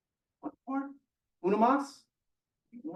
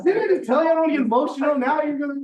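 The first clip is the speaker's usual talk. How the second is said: loud, high-pitched playful protest; exasperated but joking outburst; mock scolding; 6 semitones higher, 5 dB louder than usual. Real, not acted. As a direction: protest loudly as if scolding friends for touching you. Mock scolding friends for making him emotional.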